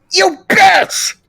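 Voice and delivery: high, loud yelp of pain and rage; pained cry. Acted. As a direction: scream out after the punch. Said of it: "Todoroki cries before grasping his nose in pain".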